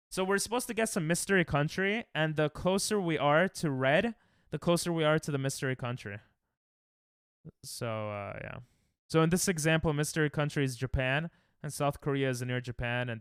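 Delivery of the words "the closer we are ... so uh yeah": ordinary talk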